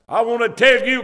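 ordinary talk

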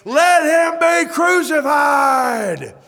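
high, slow, drawn-out furious yell; angry crowd shout. Retold or imitated. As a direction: howl it like a bloodthirsty mob. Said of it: "The same angry, emotional voices saying".

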